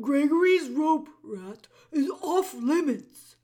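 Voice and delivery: loud, stammering gruff jailer; bellow, tripping over the words; booming jailer voice. A narrator's reading.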